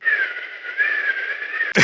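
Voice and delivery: high, eerie staring laugh; creepy high cackle. Retold or imitated. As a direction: let out a weird shrill giggle. Dracula's staring imitated.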